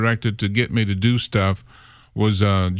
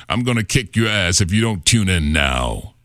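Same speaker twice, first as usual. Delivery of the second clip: mock menacing promo voice, a touch lower; tough radio imaging voice; 3 semitones lower than usual. Mimicking eighties imaging guys, lightly menacing promo read. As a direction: threaten the listener like an eighties promo guy. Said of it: "all the imaging guys sound like".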